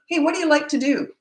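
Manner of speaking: put-on high, bright-eyed question to a child; friendly curious asking. Shown as a demonstration. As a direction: sound genuinely interested in the kid. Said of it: "you talk like this".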